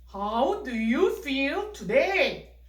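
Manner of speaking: put-on slow, deliberate model sentence for kids; 천천히 또박또박. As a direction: say each word slowly and loudly, like a teacher model. 탐 역할로 질문. (아이들에게 천천히 또박또박 들려주는 탐 목소리)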